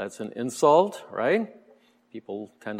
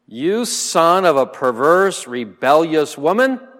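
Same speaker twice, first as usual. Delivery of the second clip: loud, higher-pitched furious king's insult; angry insult; 6 semitones higher, 9 dB louder than usual. Acted. Saul berates his son Jonathan, enraged and loud. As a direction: spit the insult at your son in rage. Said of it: "Then Saul's anger burned against Jonathan".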